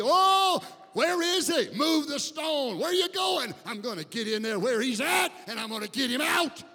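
shouted at full force, pitch way up; roaring like a bull. Acted. Jesus at the grave, charging in, roaring like a bull. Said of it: "he becomes like that bull".

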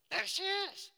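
very high, loud mock shriek; excited fan scream. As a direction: shriek it like a fan spotting a star. Imitates fan spotting the star.

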